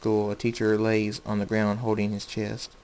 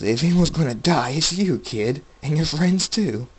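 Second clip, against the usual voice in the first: menacing thug; 5 semitones higher than usual. A narrator's reading. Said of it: "laughs and says".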